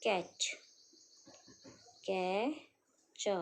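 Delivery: teacher sounding out a word; sounded out slowly. Shown as a demonstration. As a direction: catch broken into कै and च, syllable by syllable. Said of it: "बोल बोल के practice करते जानिए".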